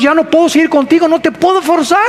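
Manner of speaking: a sad last word from God; God giving up. Retold or imitated. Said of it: "que dice Dios".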